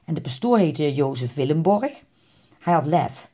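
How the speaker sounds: usual speaking voice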